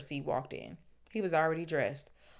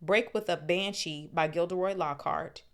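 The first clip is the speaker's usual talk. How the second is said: usual speaking voice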